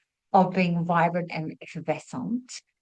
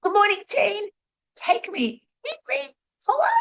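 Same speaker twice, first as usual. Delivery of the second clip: very high, squeaky, cheeky vegetable voice; talking tomatoes; 13 semitones higher than usual. Pretend. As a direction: play a flirty tomato begging to be eaten, up in a tiny high voice. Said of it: "the tomatoes and the avocados are going".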